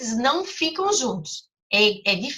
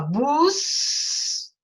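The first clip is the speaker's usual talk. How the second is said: buscam, acrescentam stretched very slowly; teacher modelling syllable words; slow example words; 3 semitones higher, much slower than usual. Shown as a demonstration. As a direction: drag the words out for the kids to split. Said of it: "tentasse separar essas palavrinhas".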